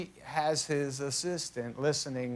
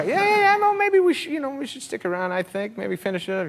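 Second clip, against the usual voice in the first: begging to stick around, pitched way up; high pleading; 11 semitones higher, 8 dB louder than usual. Retold or imitated. Pleading with Jack Nicholson to stay for the comeback, high and eager. Trying to talk Jack into staying.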